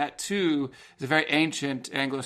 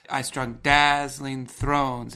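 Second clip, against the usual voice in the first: slow, louder reading that punches each beat; strong beats read; 5 dB louder, much slower than usual. Shown as a demonstration. Host reads the poem line to listeners, slow beats stressed. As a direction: read it slowly and hammer the two strong beats. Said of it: "There's the two strong beats".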